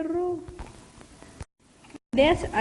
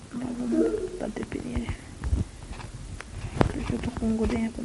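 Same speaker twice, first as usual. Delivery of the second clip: whispering; 10 dB quieter than usual. Real, not acted. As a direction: whisper the plan. Unvoiced slow.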